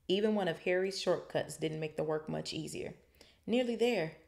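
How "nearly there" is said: panting. A narrator's reading. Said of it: "Harry panted".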